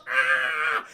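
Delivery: loud, harsh cry of pain; hammed-up agony yell; mock agonized scream. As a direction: scream as if blinded. Acting Newman hit in eyes.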